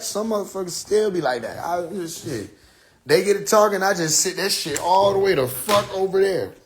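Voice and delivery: ordinary talk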